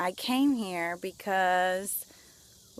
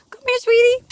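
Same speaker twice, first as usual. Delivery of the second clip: very high, loud sing-song pet voice; 14 dB louder than usual. Real, not acted. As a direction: squeal sweetly to call the dog. Calling the dog over.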